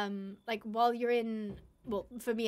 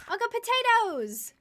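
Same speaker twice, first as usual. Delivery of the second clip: high, loud and a little drawn-out cheer; delighted shout over a lucky find; excited; 11 semitones higher, 8 dB louder than usual. Real, not acted. Finds potatoes in game.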